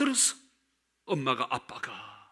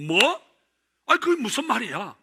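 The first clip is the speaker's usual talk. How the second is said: louder, higher startled question, quick; shocked; 7 semitones higher, 6 dB louder than usual. Retold or imitated. Pastor hearing of the divorce, retold startled. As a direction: blurt it out in shock. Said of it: "내가 놀래가지고".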